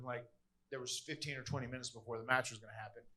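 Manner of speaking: ordinary talk, nothing marked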